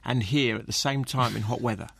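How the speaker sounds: normal talking voice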